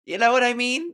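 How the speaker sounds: ordinary talk, nothing marked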